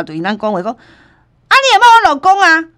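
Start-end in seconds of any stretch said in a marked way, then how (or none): 1.5-2.6 indignant wife. Retold or imitated. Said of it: "迄个太太嘛，对因讲话讲".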